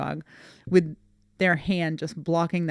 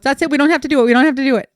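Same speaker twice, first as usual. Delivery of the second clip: retold panic, higher and louder; panicked cousin; 7 semitones higher, 7 dB louder, much faster than usual. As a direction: imitate someone frantically calling it off. Cousin panics and wants to quit.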